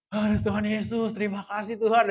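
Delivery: high-pitched acted prayer of relief; re-enacted overwhelmed thanks; grateful cry. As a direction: thank God as if barely saved. Acted thanks to God after rescue.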